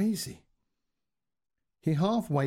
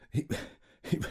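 breathy laugh; 4 dB quieter than usual. Real, not acted. Narrator breaks into a laugh.

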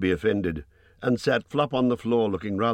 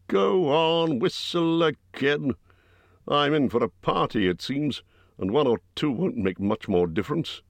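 higher, grumbling big-man voice; growling Bjorn; 8 semitones higher than usual. A narrator's reading. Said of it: "growled Bjorn".

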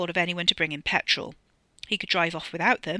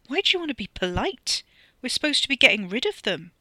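exasperated; 7 semitones higher, 5 dB louder than usual. A narrator's reading. Annoyed retort.

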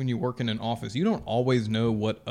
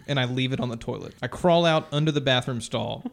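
ordinary talk

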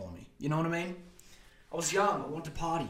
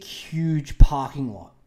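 ordinary delivery

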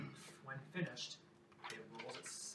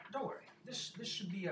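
higher, louder lightly acted reassurance; wry minstrel; 10 semitones higher, 9 dB louder than usual. Minstrel reassures travelers about the journey, wry. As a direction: wave the worry away, playing the minstrel.

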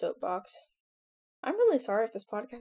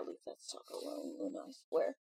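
whispered warning; 12 semitones lower, 9 dB quieter than usual. Real, not acted. Warning dog under breath.

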